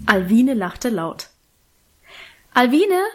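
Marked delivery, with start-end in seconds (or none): 2.5-3.0 higher, rising call of a name; calling after someone. A narrator's reading. Sister calls after the departing children, calling out. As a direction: call after them, lifting the pitch at the end. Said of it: "rief Daminchen hinter uns drein".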